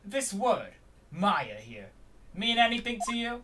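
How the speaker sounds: loud Brooklyn detective questioning; Brooklyn accent. Acted. Detective questioning suspect about the written word, Brooklyn accent. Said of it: "Let's do a Brooklyn accent".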